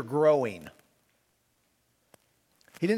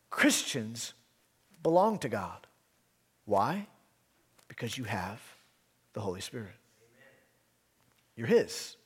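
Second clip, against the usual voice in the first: gentle reassurance; 5 semitones lower, 5 dB quieter than usual. Acted. Pastor assuring Christians they belong to God, soft and tender. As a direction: reassure the flock quietly.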